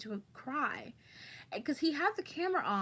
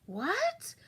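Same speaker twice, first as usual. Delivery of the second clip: shocked what; 10 semitones higher than usual. Retold or imitated. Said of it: "I'm like".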